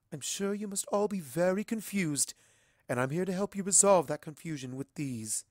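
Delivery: stately, composed royal voice; gracious ruler explaining; regal princess reassuring. Acted. Celestia addresses the ponies.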